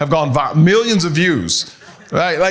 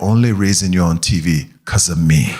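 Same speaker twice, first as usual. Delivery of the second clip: mom's voice; 10 semitones lower than usual. Retold or imitated. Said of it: "she said".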